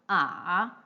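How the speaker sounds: bend "ah" down and back up; third tone. Shown as a demonstration. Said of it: "all these four main tones".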